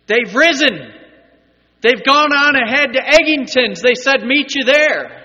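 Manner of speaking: high, loud shouted announcement; acted caretaker's holler; calling out as caretaker. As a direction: shout the news across a cemetery. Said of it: "And then the caretaker says".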